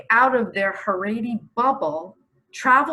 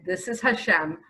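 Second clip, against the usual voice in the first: ordinary talk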